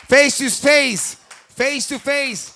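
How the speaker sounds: slow drawn-out face-off call. Real, not acted. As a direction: draw the words out slowly.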